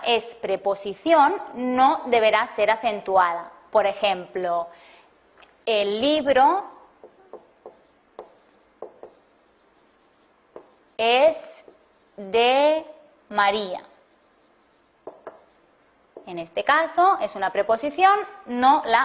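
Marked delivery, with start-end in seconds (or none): 5.7-13.8 word by word, with long gaps between words; slow dictation. Shown as a demonstration. Teacher to class, dictating an example sentence slowly. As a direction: dictate it slowly so students can write.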